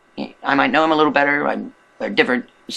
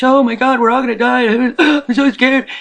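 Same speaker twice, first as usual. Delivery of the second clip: high, loud mock panic with a laugh; mock panicked sister; 10 semitones higher, 5 dB louder than usual. Sister calling on birthday, mock scared wail. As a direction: imitate the sister wailing in fear. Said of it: "she's crying".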